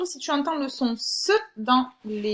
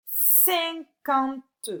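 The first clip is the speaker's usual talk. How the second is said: Cinquante split as cin-quan-te, pitched up; syllable by syllable; 4 semitones higher than usual. Shown as a demonstration. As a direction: chop Cinquante into held syllables. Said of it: "sur la première syllabe".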